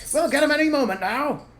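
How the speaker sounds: high, loud shout down to the others; loud high farmer's shout. Acted. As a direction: call down eagerly. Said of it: "shouted Fat Bogus".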